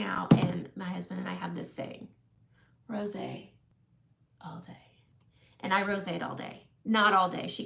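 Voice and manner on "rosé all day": soft, trailing off into near whisper; hushed catchphrase. Retold or imitated. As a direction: murmur it like a private saying. Quoting the couple's catchphrase.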